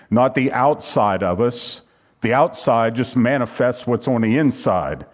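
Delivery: ordinary delivery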